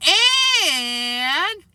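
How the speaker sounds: long, high drawn-out "and"; stretched-out word. A narrator's reading. Fudge making sure everyone listens, stretching the word. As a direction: stretch it out to hold everyone's attention. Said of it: "he stretched out the word".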